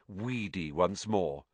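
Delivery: usual speaking voice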